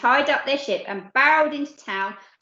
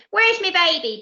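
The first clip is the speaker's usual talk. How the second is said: high, loud booming demand; a mother's booming question; boomed; 7 semitones higher, 5 dB louder than usual. Acted. Pirate mum demands her baby with a loud boom. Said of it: "boomed the captain's wife".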